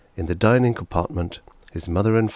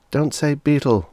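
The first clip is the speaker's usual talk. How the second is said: plain reading voice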